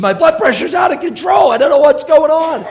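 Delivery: panicking friend crying out; alarmed shout. Retold or imitated. Friend emailing about blood pressure, alarmed shout. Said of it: "Terry wrote to me".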